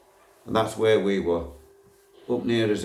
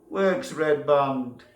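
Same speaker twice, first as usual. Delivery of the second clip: slow drawn-out shout, higher; slow shouted call; 6 semitones higher, much slower than usual. Retold or imitated. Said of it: "And I shouted".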